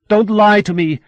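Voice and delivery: loud, high furious demand; angry demand. A narrator's reading. Mother scolds her daughter, loud and high angry demand. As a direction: snap it at her like an order. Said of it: "Mamma demanded".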